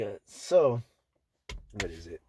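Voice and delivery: ordinary talk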